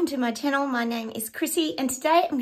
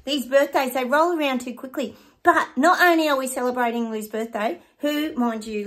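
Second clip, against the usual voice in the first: ordinary delivery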